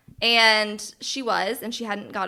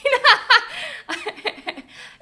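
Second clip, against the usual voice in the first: emotional laugh; 7 dB louder than usual. Real, not acted. Emotional release after moving story.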